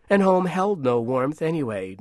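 plain reading voice

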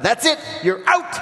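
high, hammed-up angry shout; mock outrage. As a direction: throw her out in mock fury. Host acts the outraged husband.

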